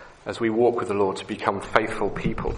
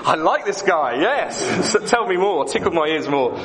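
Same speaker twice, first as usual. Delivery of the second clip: mock eager listener; 6 semitones higher than usual. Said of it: "nodding your heads going".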